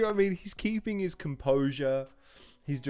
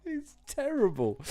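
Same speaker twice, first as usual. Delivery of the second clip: laughing; 10 semitones higher than usual. Real, not acted. Laughing at terrible bar.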